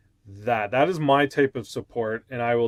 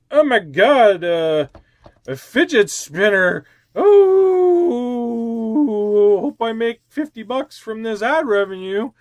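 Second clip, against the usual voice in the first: much higher and louder, hammed-up glee; mock excitement; 8 semitones higher, 5 dB louder, slower than usual. Unboxing a Loot Crate for ad money, hammed-up squealing excitement. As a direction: squeal like a kid over a fidget spinner. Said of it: "opening it and going".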